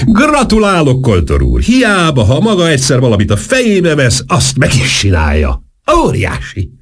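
high, swooping, excited congratulations; lelkes ordítás. A narrator's reading. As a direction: exclaim your admiration with big pitch leaps. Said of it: "ordította". (magasra szökő, lelkes kiáltás)

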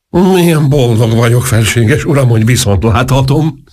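high-pitched effusive delight; overjoyed, fawning greeting; gushing. A narrator's reading. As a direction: gush with joy at the reunion. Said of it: "áradozott Antas".

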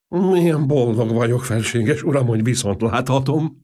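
high, gushing delight; effusive, beaming welcome. A narrator's reading. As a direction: pour out the joy of seeing him. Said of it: "áradozott Antas".

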